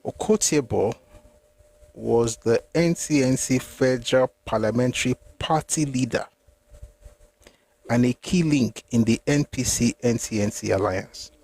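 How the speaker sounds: slow, deliberate reading broken by pauses. Shown as a demonstration. Reader wants listeners to note something, slow reading. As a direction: read it out slowly, pausing between phrases. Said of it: "I'll read it slowly".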